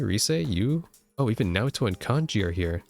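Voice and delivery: normal talking voice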